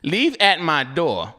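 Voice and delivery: slow, spaced-out reading of instructions; deliberate reading. Retold or imitated. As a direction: read each instruction slowly and deliberately. Reads out the delivery instructions.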